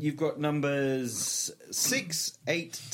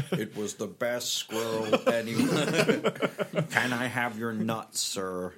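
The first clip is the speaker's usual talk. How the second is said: mock Morgan Freeman; 4 dB louder, slower than usual. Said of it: "Morgan Freeman stuff".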